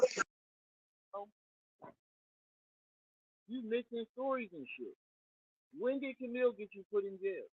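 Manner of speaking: mic turned away, faint voice; muffled. Real, not acted. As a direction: sounds like through a wall. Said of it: "You sound muffled".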